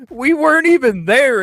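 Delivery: loud high disbelief after a laugh; loud amused indignation; incredulous, laughing outburst. Real, not acted. Repeating the false accusation.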